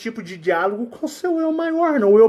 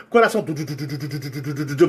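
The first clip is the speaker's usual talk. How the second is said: heartbeat imitation; 6 semitones lower, much faster than usual.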